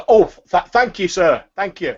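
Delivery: a pleased market woman gushing; grateful turnip seller. Acted. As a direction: thank him, thrilled by the coins. Seller thanks for tip.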